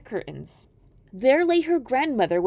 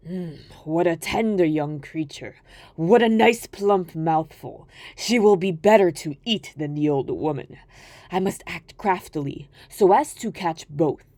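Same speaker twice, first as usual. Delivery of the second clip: low, gloating wolf voice; a wolf plotting to eat them both; greedy wolf; 5 semitones lower than usual. Acted. Wolf thinks to himself.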